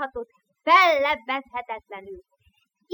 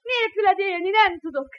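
pleading through tears; tearful; 4 semitones higher than usual. Acted. The little brother begs Krisztián, crying. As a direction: beg him, voice crying.

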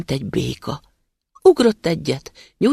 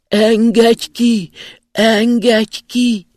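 slow, high, loud pleading cries from underground; a trapped spirit calling out to be freed, drawn out and loud; muffled trapped cry; 8 semitones higher, 8 dB louder, much slower than usual. A narrator's reading. Trapped spirit begging the passing student: slow, high, muffled cry. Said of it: "és újból rákezdte".

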